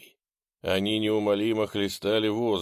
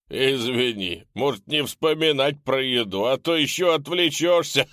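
high, loud mocking ghoul; snarling with hyena giggles; 4 semitones higher, 5 dB louder than usual. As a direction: jeer at the fat hero.

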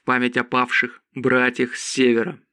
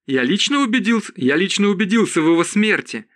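high, insistent exclamation; disbelieving cry; 7 semitones higher, faster than usual. A narrator's reading. Zod swears he checked the dragon's death, high insistent cry. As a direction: insist with voice raised in pitch. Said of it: "крикнул Зод".

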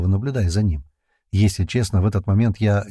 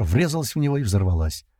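ordinary delivery

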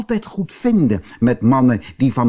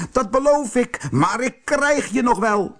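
very high-pitched angry threat; furious shriek; 13 semitones higher than usual. A narrator's reading. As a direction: screech it high as an enraged wizard. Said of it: "gilde de tovenaar woedend". (woedend, hoog gegild dreigement)

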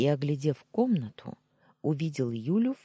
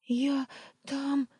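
high, tearful stammer; 12 semitones higher than usual. A narrator's reading. Tearful wife starts to explain, high and stammering. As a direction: stammer it through tears. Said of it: "заикаясь".

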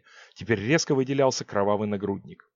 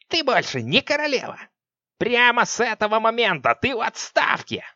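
menacing witch; 9 semitones higher, 3 dB louder than usual. Acted. Witch mocking deposed queen.

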